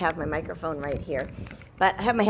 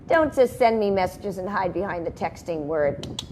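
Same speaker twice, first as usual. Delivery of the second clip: ordinary delivery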